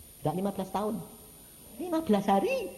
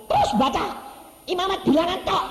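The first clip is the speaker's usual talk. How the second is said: retold loud advice; 10 dB louder than usual. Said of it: "Lalu saya bilang".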